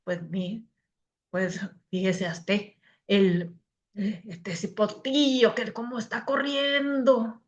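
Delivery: put-on soft Salvadoran accent with a drawn-out singsong end; put-on Salvadoran Spanish. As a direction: imitate a Salvadoran, quietly and hesitantly at first. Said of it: "O el salvadoreño".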